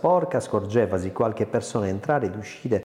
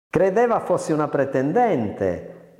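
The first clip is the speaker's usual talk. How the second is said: said laughing; 7 semitones higher, slower than usual. A narrator's reading. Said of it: "disse Carina ridendo".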